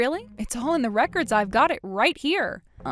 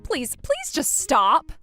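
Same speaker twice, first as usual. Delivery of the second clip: desperate plea; 5 semitones higher, much slower than usual. Acted. Begging ex-husband pleads with Christine.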